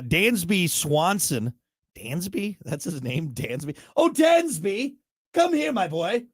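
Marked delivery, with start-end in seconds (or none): 4.0-6.2 put-on high, lilting posh British calling a boy. Host mocking the name Dansby in posh British accent. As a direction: call him like a posh British aunt. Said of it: "very posh, very British".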